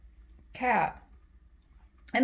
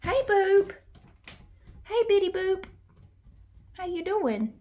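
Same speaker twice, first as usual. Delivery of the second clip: much higher, louder cheery greeting; high pet greeting; 11 semitones higher, 4 dB louder than usual. Real, not acted. Greeting the cat as she comes in, high loud.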